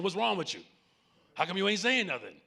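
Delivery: mock exasperated yell at a silent teen, fast; imitating a yelling parent. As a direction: fire off the questions like a frustrated parent. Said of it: "we yell at them for not talking".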